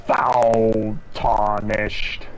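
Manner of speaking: drawn-out, very slow boss taunt; game boss. Acted. Player mimicking the boss as it appears, slow role voice. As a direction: intone it grandly, stretching each word.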